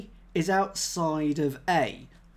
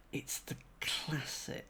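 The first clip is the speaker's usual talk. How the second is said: whispered, softer and slow; 13 dB quieter, slower than usual. Shown as a demonstration. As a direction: breathe it like a secret to yourself.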